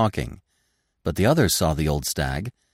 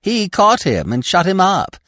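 high, loud chattering storyteller; excited, high-pitched animal gossip; chattering squirrel; 9 semitones higher, 6 dB louder than usual. A narrator's reading. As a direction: chatter away eagerly as a squirrel. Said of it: "the squirrel began to chatter again".

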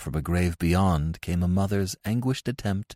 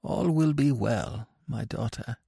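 soothing priest; 6 semitones higher than usual. Acted. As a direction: reassure in a raised, gentle voice.

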